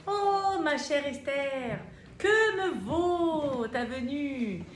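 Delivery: high, loud, slow royal proclamation; a king lavishly welcoming his queen; grand king voice. Acted. King greeting Esther, high, loud and slow.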